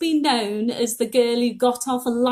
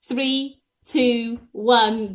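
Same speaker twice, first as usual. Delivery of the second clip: normal talking voice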